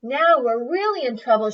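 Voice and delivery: loud worried shout. Acted. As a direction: shout the alarm. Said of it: "shouted Papa Grunt".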